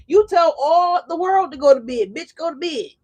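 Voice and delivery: sassy excited order; hyped-up bossy command. Acted. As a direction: lord it over the world, loud and high. Hyping the Leo viewers.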